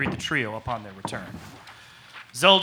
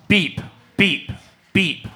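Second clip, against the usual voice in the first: beeping imitation; 4 semitones higher, slower than usual. Imitating the alarm.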